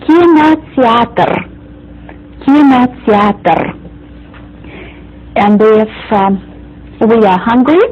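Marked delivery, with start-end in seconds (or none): 0.0-3.6 Kinoteatr said slowly, each syllable clear; slow teacher demonstration; slow model word. Shown as a demonstration. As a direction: draw out Kinoteatr for repeating. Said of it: "we complete it with the letter R".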